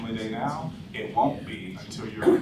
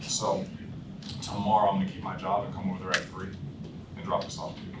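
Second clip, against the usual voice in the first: softer, lower, unbothered delivery; just a guy trying to keep his job; low-key, unsalesy; 5 semitones lower, 6 dB quieter than usual. Shown as a demonstration. Salesman on the phone playing bored employee, softer and lower. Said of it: "I don't sound like I'm trying to sell".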